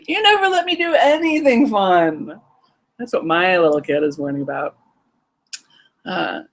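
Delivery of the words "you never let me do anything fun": put-on higher, whiny child complaint; complaining kid. Inner child grumbling at the parent, higher. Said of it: "I wrote down one earlier".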